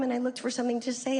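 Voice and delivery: ordinary talk, nothing marked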